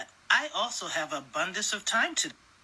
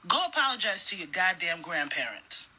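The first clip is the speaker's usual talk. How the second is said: normal talking voice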